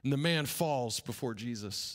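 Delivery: ordinary delivery, nothing marked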